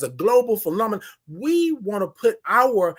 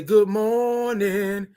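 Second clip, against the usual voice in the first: long sing-out "Good morning"; very slow, held-out greeting to a listener; drawn-out greeting; 2 semitones higher, much slower than usual. Real, not acted. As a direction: stretch each vowel of the hello.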